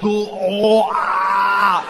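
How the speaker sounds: high, groaning mock Scottish accent; Scottish brogue as if fighting back vomit; Scottish accent, retching. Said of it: "centuries of people trying not to throw up".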